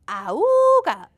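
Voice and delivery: high loud honking ahooga; clown horn honk. A narrator's reading.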